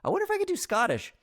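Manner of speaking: high, fast, giddy trial voice; mock voice-testing parent. Parent trying out voices while reading to a baby, giddy high voice. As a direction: squeak it out like a dad testing accents. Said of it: "you're gonna try out so many good voices".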